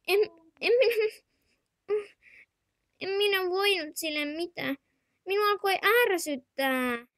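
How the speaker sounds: put-on high, young child's voice calling auntie; little child asking auntie. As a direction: play a small whiny kid. Child figure defends itself.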